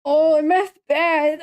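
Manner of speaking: hamming up a clueless panicked parent; mock panicked parent. Streamer mocking people who think ADHD medication is meth, loud and high. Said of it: "They're like".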